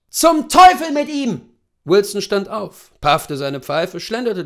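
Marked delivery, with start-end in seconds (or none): 0.1-1.4 high, loud furious outburst; damn him with full force; angry curse. Acted. Husband curses the servant.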